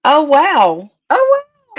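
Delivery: high, loud gasp of real delight; real shock at the good news; surprised delight. Real, not acted. Hears she hit 500 subscribers.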